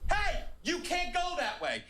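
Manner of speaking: high, sharp call, slow on the words after it; woman calling out. Retold or imitated. Woman warning the man off a second time, high call. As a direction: stop him from walking on. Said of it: "and she goes".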